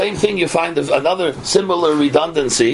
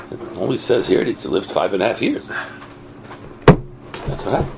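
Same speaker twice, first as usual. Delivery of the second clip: normal talking voice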